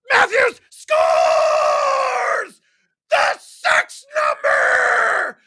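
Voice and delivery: drawn-out screaming goal call; ecstatic goal call. Real, not acted. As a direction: scream it like a hockey announcer. Goal call on live stream.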